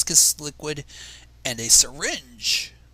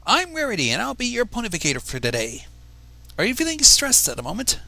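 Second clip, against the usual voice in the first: higher, louder bright pony doctor; showy pony greeting, raised and louder; Rarity voice; 6 semitones higher, 5 dB louder than usual. Acted. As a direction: introduce yourself grandly, pitched up. Rarity introduces herself to Ethan.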